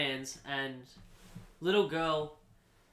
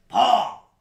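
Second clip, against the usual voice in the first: much louder, higher name; loud correction; 8 semitones higher, 13 dB louder than usual. Retold or imitated. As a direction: bark the name out. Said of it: "he goes".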